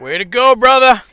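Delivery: loud cheering shout; passing cyclist calling out. Real, not acted. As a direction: yell encouragement at full voice.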